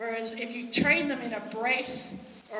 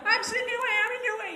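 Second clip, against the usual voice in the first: high, loud horse voice, insisting; stubborn horse; 11 semitones higher, 5 dB louder, much faster than usual. Retold or imitated. The horse refusing to move, stubborn high voice. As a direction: plant your feet and insist in a high voice. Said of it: "the horse is like".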